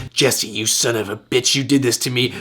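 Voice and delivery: quiet mock fury held in; suppressed scream. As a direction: swallow the scream and say it low. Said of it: "in my little ear wanting to scream".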